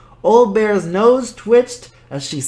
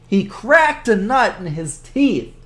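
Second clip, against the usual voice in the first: plain reading voice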